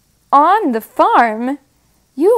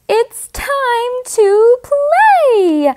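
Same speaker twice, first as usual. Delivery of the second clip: high, slow squealy pig voice; excited pig voice; 12 semitones higher, slower than usual. Acted. Pig speaks in story.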